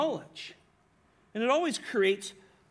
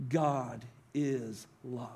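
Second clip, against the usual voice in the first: slow hushed reminder; 7 semitones lower, 4 dB quieter, much slower than usual. Acted. Reminder he writes himself.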